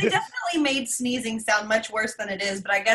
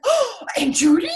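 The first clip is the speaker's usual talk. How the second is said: high, loud delighted "Oh, Aunt Judy?"; surprised gasp; 9 semitones higher, 5 dB louder than usual. Retold or imitated. Daughter spotting Aunt Judy on screen, squealing with surprise. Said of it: "she was like, gasps".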